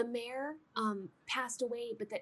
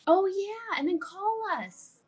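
put-on high, eager child voice; a kid eagerly telling Fred to call; excited kid; 7 semitones higher, 5 dB louder than usual. Kids excitedly plan call.

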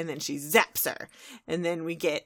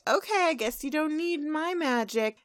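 higher-pitched, airy walk-away taunt; feigned indifference; 8 semitones higher than usual. Acted. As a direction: toss it off high as if leaving. Twilight walking away, acted taunt.

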